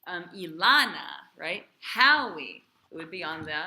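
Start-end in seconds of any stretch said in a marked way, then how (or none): 0.4-1.2 "Ilana" with a loud, punched stressed syllable. Shown as a demonstration. Teacher shows where a name's accent falls, stressing "Ilana" mid-word. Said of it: "tells you where the emphasis and the accent is". 1.8-2.6 "Howie" with a loud, punched first syllable; stressed syllable. Shown as a demonstration. Teacher shows where a name's accent falls, stressing the start of "Howie". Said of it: "tells you where the emphasis and the accent is".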